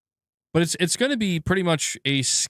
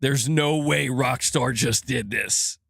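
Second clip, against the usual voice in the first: mock clickbait title; slower than usual. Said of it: "I'ma just type titles like".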